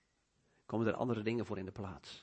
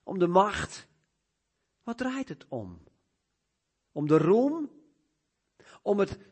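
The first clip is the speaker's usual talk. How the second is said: high, insistent string of questions; searching preaching; 9 semitones higher, 8 dB louder than usual. Real, not acted. Preacher probing what life really revolves around, searching and insistent. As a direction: press each question upward, pausing between.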